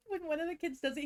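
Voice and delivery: ordinary talk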